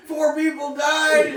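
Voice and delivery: hammed-up breathless news anchor, high and loud. Friends mocking TV news, a hammed-up alarmed anchor. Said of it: "they'd be like".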